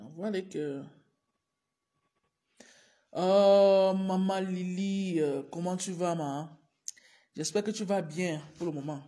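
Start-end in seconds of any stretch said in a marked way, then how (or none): none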